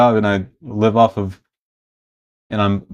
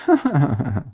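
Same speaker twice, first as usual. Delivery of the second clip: cackling villain laugh; menacing Joker cackle; 4 semitones higher, 3 dB quieter than usual. Shown as a demonstration. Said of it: "twenty twelve Joker laugh".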